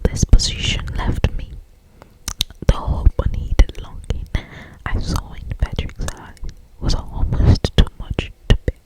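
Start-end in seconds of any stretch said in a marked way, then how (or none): none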